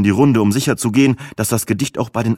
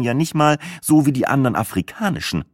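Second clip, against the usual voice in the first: ordinary delivery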